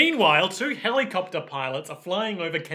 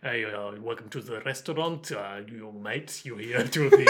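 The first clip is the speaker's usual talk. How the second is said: put-on vague Liverpool-French accent; 5 semitones lower than usual. Imitating a TV actor for a co-host, vague Liverpool-French accent. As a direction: try a murky Scouse-meets-French accent. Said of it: "the accent that he's doing".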